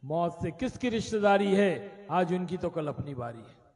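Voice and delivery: poem recital. Acted. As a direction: recite the couplets gravely. Recited couplets.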